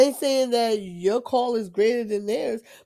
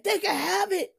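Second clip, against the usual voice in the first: in my feelings; 8 semitones higher than usual. Retold or imitated. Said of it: "I was in my feelings".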